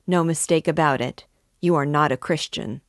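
plain reading voice

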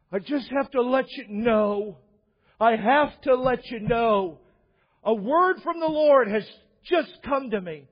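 fake-weeping preacher. Televangelist announcing a revelation to viewers, mock weeping. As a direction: put on high, straining pleas like fake weeping. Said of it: "It was fake weeping".

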